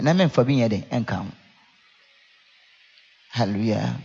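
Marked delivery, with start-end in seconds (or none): none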